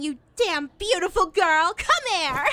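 loud, exuberant affection; gushing gratitude. Acted. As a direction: shout it as you go in for a hug. Gushing thanks before a hug.

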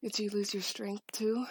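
ordinary delivery

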